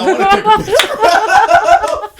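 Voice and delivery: laughing defiant yell at the crowd; defiant shout. Retold or imitated. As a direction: fire it back defiantly.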